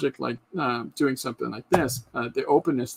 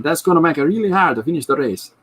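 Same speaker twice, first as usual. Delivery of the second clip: hammed-up cliché Italian accent, higher and louder; 6 semitones higher, 6 dB louder than usual. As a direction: play a stock Italian guy with a thick Italian accent. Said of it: "cliche Italian accents".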